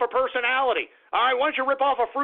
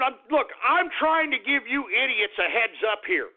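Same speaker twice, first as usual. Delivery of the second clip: ordinary talk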